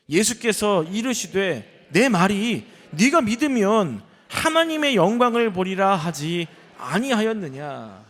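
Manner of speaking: very slow, emphatic proclamation of a verse; scripture reading. Acted. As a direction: read it out loud in drawn-out phrases. Reads scripture aloud to congregation.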